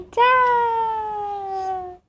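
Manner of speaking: high, long held bye-bye; modelling goodbye. Shown as a demonstration. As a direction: sing out a stretched, high goodbye. Models waving bye for baby. (tchau agudo e prolongado)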